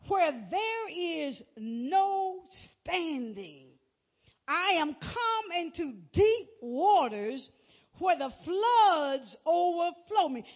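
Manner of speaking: slow, drawn-out psalm reading; scripture reading. Acted. Preacher reading David's plea to God, slow and drawn out. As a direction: stretch each phrase, pausing between. Reads the psalm aloud in sermon.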